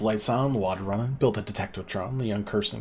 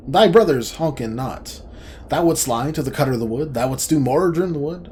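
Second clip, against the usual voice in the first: louder, higher, faster furious charge; angry accusation; 5 semitones higher, 9 dB louder than usual. Acted. Cutter of the Wood angrily confronts the lying brother. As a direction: bark the accusation at the liar.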